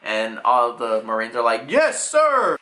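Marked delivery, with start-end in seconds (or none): 1.7-2.6 high, crisp mock military shout; hammed-up recruit's reply; soldiers' acknowledgement. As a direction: answer an order like an eager marine. Marines answer an order.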